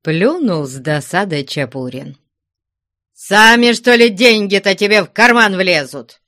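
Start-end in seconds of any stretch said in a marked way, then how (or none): 3.1-6.2 loud, raised voice, scolding; indignant cry. A narrator's reading. Chapurin snapping at Vasily over money, indignant cry. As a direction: cry it out indignantly, louder and higher. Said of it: "крикнул он".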